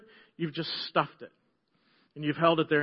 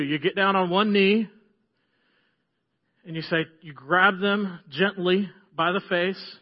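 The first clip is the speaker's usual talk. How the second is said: ordinary talk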